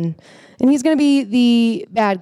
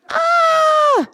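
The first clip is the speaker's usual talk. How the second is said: panicked scream. Retold or imitated. Mordecai panics at the sign.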